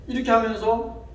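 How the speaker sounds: usual speaking voice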